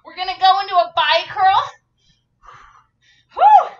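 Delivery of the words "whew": loud high breathy whew; exhaled whew. Real, not acted. Mid workout exertion.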